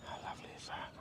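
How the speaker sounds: hushed whisper, barely voiced. Real, not acted. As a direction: whisper it so as not to disturb. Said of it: "I feel like I have to whisper".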